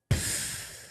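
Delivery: lips blown in a soft fart; fart noise. Retold or imitated. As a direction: puff a quiet fart sound. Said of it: "then she'd go".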